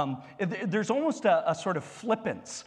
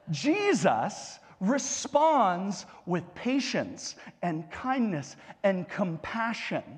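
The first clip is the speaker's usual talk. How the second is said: ordinary talk, nothing marked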